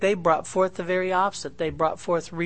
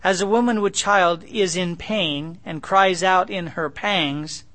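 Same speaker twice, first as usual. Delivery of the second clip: louder, slow solemn reading of the verse; scripture reading; 3 semitones higher, 4 dB louder, slower than usual. Acted. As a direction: proclaim the verse to the congregation.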